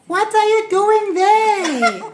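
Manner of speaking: slow, high, laughing disbelief; laughing surprise. Real, not acted. To Johnny, caught in the tea, laughing slowly.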